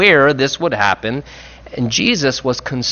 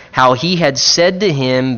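ordinary talk, nothing marked

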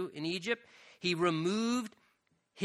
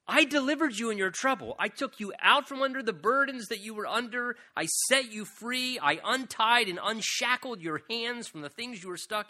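raised, pleading voice of God, full force; preaching as God; 6 semitones higher than usual. Acted. Preacher voicing God pleading with his people, raised and urgent.

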